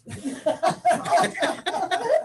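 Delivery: long high rising laugh; amused laughter at the story. Real, not acted. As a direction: break up laughing. Laughing at the crying man.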